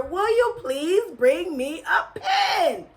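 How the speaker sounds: slow, high, emphatic plea; exasperated father. A narrator's reading. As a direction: spell out every word impatiently. Father repeats slowly, exasperated.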